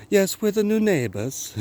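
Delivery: high-pitched mock British accent. Neighbours introducing themselves to him, high mock British accent. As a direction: put on a high, exaggerated British voice. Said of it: "I do love to do British accents".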